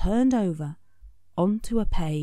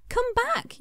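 Scribble calling. A narrator's reading. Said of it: "yelled Scribble".

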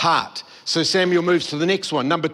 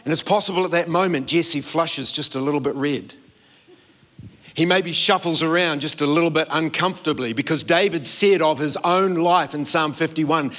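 ordinary talk, nothing marked

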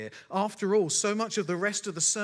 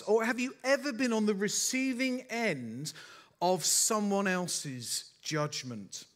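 normal talking voice

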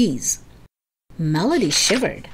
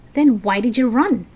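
snapped; 4 semitones higher, 4 dB louder than usual. A narrator's reading. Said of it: "Melody snapped".

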